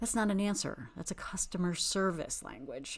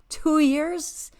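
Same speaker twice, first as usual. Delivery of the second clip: loud, much higher, rising disbelief; incredulous; 10 semitones higher, 9 dB louder than usual. Real, not acted. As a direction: echo it back in outraged disbelief. Outraged at Mel's answer.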